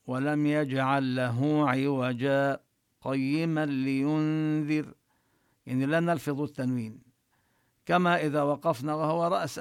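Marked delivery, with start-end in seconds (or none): 0.1-4.9 slow chanted recitation linking the words; tajweed recitation demo. Shown as a demonstration. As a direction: recite slowly, the words joined. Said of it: "نقرأ".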